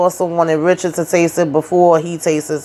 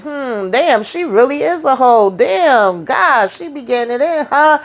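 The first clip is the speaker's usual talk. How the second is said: gossiping woman; 7 semitones higher than usual. Retold or imitated. Friend gossips about the wife.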